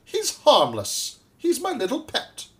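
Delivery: high doting lady, falling at the end; swooping, fond lady's voice. A narrator's reading. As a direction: gush over a beloved pet. Lady reassures about her pet.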